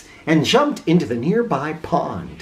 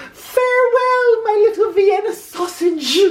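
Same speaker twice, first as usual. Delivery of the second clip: mother calling out; 4 dB louder than usual. A narrator's reading. Oscar's mother calling from the yard, high and doting. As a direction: sing out a fond goodbye. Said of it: "waving and calling out".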